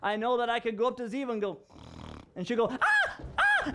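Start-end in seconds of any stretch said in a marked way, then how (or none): 2.7-3.7 very high playful squeals; mock scared squeal of a daughter; imitated child squeal. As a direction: shriek like a little girl running off.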